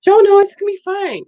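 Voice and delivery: high, loud brushing-off nurse voice; mock dismissive nurse. Nurse voiced in retold exchange.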